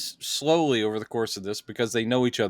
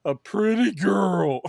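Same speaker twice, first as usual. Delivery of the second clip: slow, higher goofy voice for the astronaut; dopey astronaut voice; 8 semitones higher, 3 dB louder, slower than usual. Retold or imitated. Astronaut in the capsule reporting the UFO, slow goofy voice. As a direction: play the astronaut as a slow dimwit.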